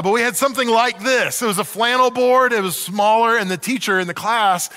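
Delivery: ordinary delivery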